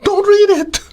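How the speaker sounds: mock exasperated high command; blunt advice. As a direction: fire it back high and incredulous. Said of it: "I go".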